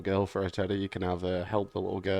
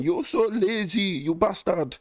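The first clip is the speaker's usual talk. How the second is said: high, louder mock scolding; pushy trainer impression; 11 semitones higher, 4 dB louder, slower than usual. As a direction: scold in a high put-on woman's voice. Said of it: "she's so pushy about it".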